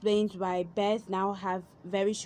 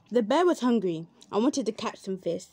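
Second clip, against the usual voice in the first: plain reading voice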